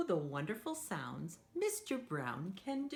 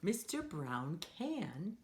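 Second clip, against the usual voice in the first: usual speaking voice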